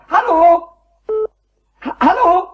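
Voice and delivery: high, loud, half-laughing protest; exasperated outburst at the phone; frantic shouting. Acted. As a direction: shout down the talking clock. Arguing with time announcement.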